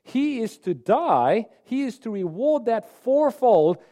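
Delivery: high, loud, slow enraged outburst; furious king. Acted. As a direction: roar it out as the outraged king. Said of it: "he was filled with rage".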